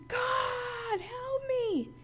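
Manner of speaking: retold scream. Pinned by demons, crying to God for help, high scream. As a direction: cry out for help in terror, pitch way up. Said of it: "I, I screamed".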